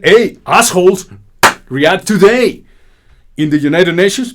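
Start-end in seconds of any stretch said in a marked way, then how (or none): 0.0-2.6 mock shout. Quoting Petro's rebuke.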